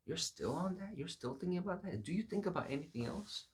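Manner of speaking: high-pitched, mock-incredulous questions; incredulous questioning. Onlookers questioning David's one-track mind, incredulous.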